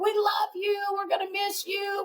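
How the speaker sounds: high, sing-song group cheer of love; excited chorus. Retold or imitated. Narrator voicing the men cheering their departing friend, sing-song and excited. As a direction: gush it like a crowd of friends. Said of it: "the men all come running out and grabbing him".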